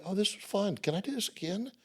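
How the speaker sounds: put-on eager kid.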